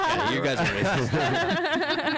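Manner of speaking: high, slow giggling accusation; laughing. Real, not acted. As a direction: say it while cracking up. Joking accusation through laughter.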